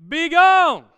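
long, high, very loud command; shouted banishment. Shown as a demonstration. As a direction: shout the gremlin away. Leads crowd banishing the gremlin.

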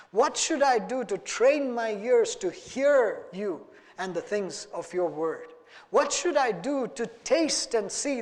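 slow, pleading prayer voice; earnest prayer. Acted. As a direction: ask the Lord humbly and intently. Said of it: "that we would be able to say".

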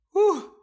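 sharp high exhale, loud; a loud high whew recalling his rage. Retold or imitated. Recalling the day he got angry, loud high whew.

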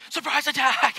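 high, loud comic shout; hammed-up rally cry; mock battle cry. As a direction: call the ambush playfully. Calling out family ambush.

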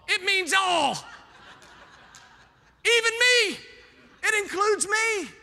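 loud, high preaching cry; preacher lifting the whole room; preaching shout. Real, not acted. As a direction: belt it out like a preacher at full force. Preacher shouting climax about all.

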